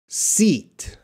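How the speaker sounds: long ee in "seat", lips spread wide. Shown as a demonstration. Teacher modelling "seat" as a long sound. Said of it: "Look how my mouth spreads out".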